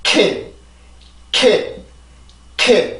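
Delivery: "K" as one short, high burst; model pronunciation. Shown as a demonstration. Teacher drilling the sound for learners, model pronunciation. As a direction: say each "K" crisply on its own with a pause after. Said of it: "그냥 바로 해봅시다".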